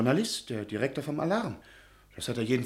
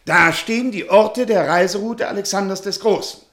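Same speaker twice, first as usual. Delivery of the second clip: louder and louder, eager to prove it; excited protest; 5 semitones higher, 9 dB louder than usual. Acted. Professor insisting to Butschan, excited and loud. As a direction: push your case with rising volume.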